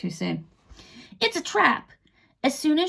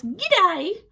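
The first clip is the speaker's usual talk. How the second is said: Australian accent; 9 semitones higher than usual. Shown as a demonstration. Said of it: "speaks Australian".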